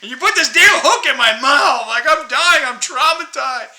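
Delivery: lightly voiced outraged fish; mock fish voice. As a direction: play the hooked fish cursing you out. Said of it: "the fish is like".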